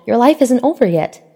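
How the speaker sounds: heartfelt protest; passionate. A narrator's reading. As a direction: insist he still has time. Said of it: "her voice passionate".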